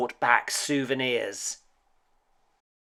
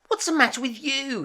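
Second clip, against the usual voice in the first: mum; 4 dB louder, faster than usual. Acted. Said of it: "his mum asked".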